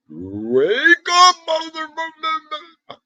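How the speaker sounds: squeaky cartoon voice, very high and slowly stretched; hammed-up high mock wake-up yell, dragging every word; Mike's alarm voice. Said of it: "I'm gonna get his voice".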